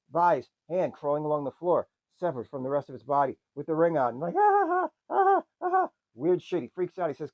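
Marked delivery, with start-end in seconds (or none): 4.4-5.9 much higher, louder hammed-up shrieks; mock screams. Tom seeing the crawling hand, hammed-up screaming. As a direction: scream like a horror victim.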